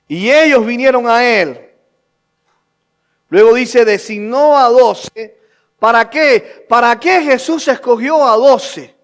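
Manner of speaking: ringing sermon questions; preaching at full force. Real, not acted. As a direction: preach it loud to the congregation.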